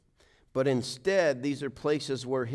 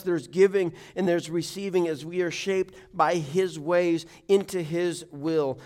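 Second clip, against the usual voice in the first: usual speaking voice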